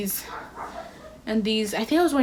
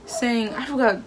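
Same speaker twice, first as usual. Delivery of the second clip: usual speaking voice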